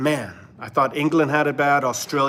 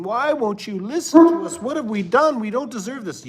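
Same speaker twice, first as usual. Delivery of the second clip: higher, pleading wail of the fallen city; imitating Jerusalem lamenting, higher and pleading; mock lament; 8 semitones higher, 3 dB louder than usual. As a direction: voice a crowd crying out in protest. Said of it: "crying and lamenting".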